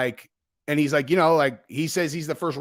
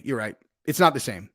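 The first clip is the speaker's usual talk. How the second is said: normal talking voice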